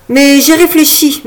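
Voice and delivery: a hint of a mischievous grin. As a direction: tease her with a mischievous lilt. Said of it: "me dit-il malicieusement".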